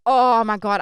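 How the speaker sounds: loud, overwhelmed outburst. Retold or imitated. Woman reliving his return at the door, overwhelmed. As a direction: burst out loudly with the feeling.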